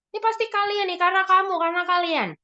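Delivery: high-pitched accusation; mock blaming. Punisher blaming the children, acted high accusing voice. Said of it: "Blaming, menyalahkan".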